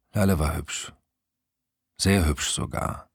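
plain reading voice

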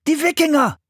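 high-pitched warning shout; urgent, high exclamation; alarmed cry. A narrator's reading. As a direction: cry out the danger like a frightened boy. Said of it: "schrie Philipp".

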